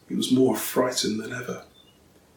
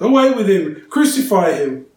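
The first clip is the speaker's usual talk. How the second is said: loud, higher yell; the crowd yelling to take him away; 7 semitones higher, 7 dB louder than usual. A narrator's reading. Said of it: "they yelled".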